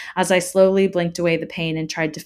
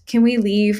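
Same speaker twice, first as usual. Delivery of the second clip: raised, slightly tearful plea; choked pleading; 4 semitones higher, 6 dB louder than usual. A narrator's reading. Sobbing child begging father, mildly choked plea. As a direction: ask with a touch of choked upset. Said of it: "I choked".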